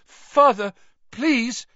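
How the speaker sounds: high, loud desperate plea; pleading. A narrator's reading. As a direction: beg your father for mercy. Terrified son begging father.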